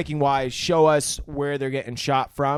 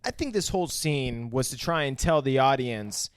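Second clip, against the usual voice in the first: normal talking voice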